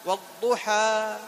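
والضحى slow with the final alif drawn out; long alif. Shown as a demonstration. Teacher demonstrates the alif of والضحى. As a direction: stretch the ending of والضحى. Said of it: "لاحظ".

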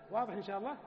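normal talking voice

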